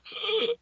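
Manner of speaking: sharp high inhale; gasping wheeze. Shown as a demonstration. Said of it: "concerned with the".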